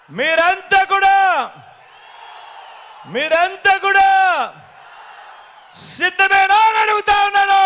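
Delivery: rally a huge crowd, pitch rising phrase by phrase; ringing political battle cry; rousing rally call. Real, not acted.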